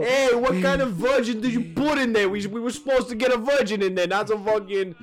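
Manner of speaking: high, loud hammed-up complaint; mock angry Roman. Romans complain about the sacrifice, mock Italian rant.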